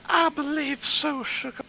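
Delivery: ordinary talk, nothing marked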